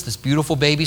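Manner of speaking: ordinary delivery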